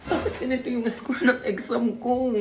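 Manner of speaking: hammed-up tearful whine; crying. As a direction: wail over a bad exam result. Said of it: "wag ka na umiyak".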